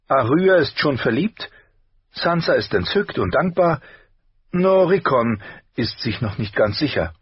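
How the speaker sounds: high-pitched, lively mother; mother's voice. A narrator's reading. Wife reports on the children and pups, high mother's voice. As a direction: raise the voice well up for the wife's report.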